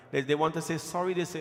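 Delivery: ordinary delivery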